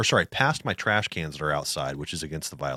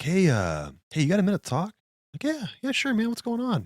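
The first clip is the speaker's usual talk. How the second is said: imitating the neighbor pitched higher; higher mock neighbor voice; 13 semitones higher than usual. Imitating the neighbor on the phone, pitched higher. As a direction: raise your voice to play the neighbor on the phone.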